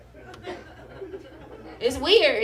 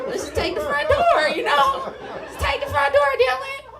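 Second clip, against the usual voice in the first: laughing plea; 12 semitones higher, 5 dB louder than usual. Retold or imitated.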